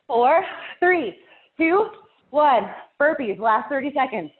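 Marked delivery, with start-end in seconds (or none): 0.1-2.7 energetic countdown. Real, not acted. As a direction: shout the count like a coach. Workout countdown, calling out.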